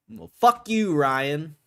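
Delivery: high, loud yelled insult; mock angry outburst. Real, not acted. Streamer yelling at the CEO, loud mock fury. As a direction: shout it at him, half joking.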